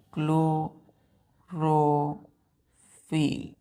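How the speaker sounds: very slow dictation with long pauses. Shown as a demonstration. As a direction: dictate each word for the class to write down. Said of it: "star दे के लिखिएगा".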